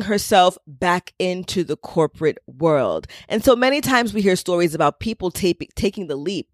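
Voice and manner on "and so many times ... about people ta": ordinary talk, nothing marked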